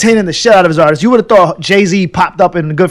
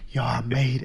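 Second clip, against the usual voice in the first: much softer, awed murmur to himself; awed hush; 6 semitones lower, 14 dB quieter than usual. Retold or imitated. Outside the building, he murmurs softly in awe. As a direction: breathe it out in wonder.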